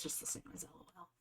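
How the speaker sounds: whispered aside. Real, not acted. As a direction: mutter it to yourself, barely voiced. Aside then repeated aloud.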